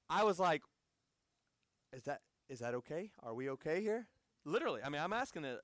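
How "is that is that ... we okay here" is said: low, hushed, mildly worried question; nervous checking. Retold or imitated. Visitor asking his host if preaching is safe, hushed and uneasy. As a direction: check discreetly, with a touch of worry.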